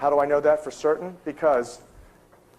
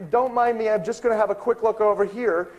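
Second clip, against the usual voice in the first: higher-pitched polite insistence; mock-casual excuse; 6 semitones higher than usual. Researcher putting off the librarian, mock-casual. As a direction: lightly wave off help, pitched up. Brushing off the librarian once more.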